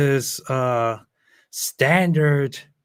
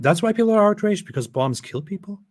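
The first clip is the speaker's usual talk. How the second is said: mock amazement; 9 semitones higher, 4 dB louder than usual. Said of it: "sarcastic realisation".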